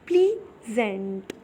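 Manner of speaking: Pleasant slowly split as plea, gent; two drawn-out halves of pleasant with a gap between; syllable by syllable. Shown as a demonstration. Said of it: "आप एक बार बोल करके देखिए". (Pleasant को धीरे, दो हिस्सों में तोड़ कर)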